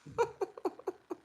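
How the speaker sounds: a sudden high laugh, real amusement; high burst of laughter. Real, not acted. Laughing at a viewer's claim in the live chat.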